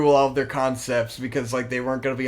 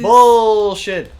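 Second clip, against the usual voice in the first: loud annoyed outburst; frustrated outburst; 7 semitones higher, 10 dB louder than usual. Real, not acted. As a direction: snap it out in frustration. Frustrated at game.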